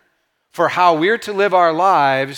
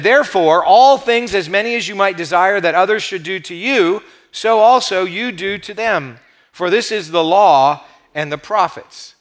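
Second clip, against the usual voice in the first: emphatic, raised reading that opens loud and slows; scripture reading; 3 semitones higher, 3 dB louder than usual. Real, not acted. Preacher reads Jesus' words to the congregation, proclaimed and deliberate. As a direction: proclaim the verse, stretching out the closing phrases.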